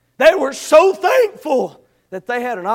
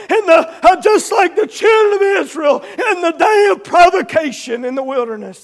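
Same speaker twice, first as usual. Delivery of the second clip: raised, insistent pulpit voice; full-force preaching; 6 semitones higher, 7 dB louder than usual. Real, not acted. Preacher condemning unbelief and hardened hearts, full-force preaching. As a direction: drive each charge home, high and loud.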